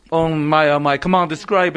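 talking through a mouthful of bread; words muffled round a bite; mouth full. Real, not acted. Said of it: "My mouth is full".

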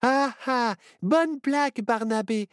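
very high, grumpy owl character; mock a friend's bad joke; high-pitched, unamused retort; grumbled sarcasm. A narrator's reading. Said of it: "grogna Myrtille".

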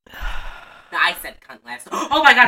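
ordinary delivery

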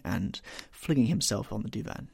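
ordinary delivery, nothing marked

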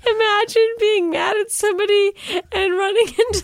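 high, fast laughing mimicry; high laughing impression. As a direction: voice him high while laughing. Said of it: "he was like".

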